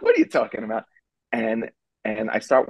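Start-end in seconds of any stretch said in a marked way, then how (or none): 0.0-0.8 high, disbelieving question; baffled. Retold or imitated. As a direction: sound totally baffled.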